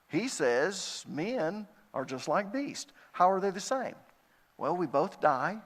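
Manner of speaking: ordinary talk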